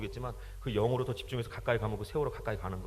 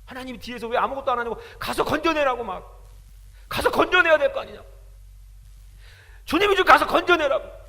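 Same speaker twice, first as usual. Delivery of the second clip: loud, high, pleading shout; anguished shouted plea; retold angry demand; 10 semitones higher, 12 dB louder than usual. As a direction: demand that he go rescue them. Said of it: "한참을 따졌어요".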